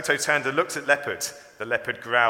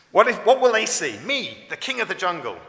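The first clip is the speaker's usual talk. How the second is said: high, loud, swaggering proclamation; boastful would-be king; 7 semitones higher, 9 dB louder than usual. A narrator's reading. Toto calling himself king of the jungle, boastful. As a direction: puff yourself up and declare it grandly.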